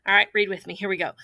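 ordinary talk, nothing marked

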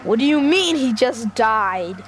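usual speaking voice

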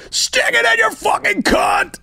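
high, slow mock rock-singer shout; imitating the frontman's yell. Retelling the embarrassing gig moment, high hammed-up rock shout. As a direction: bellow it like a frontman egging on a silent crowd. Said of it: "when he goes".

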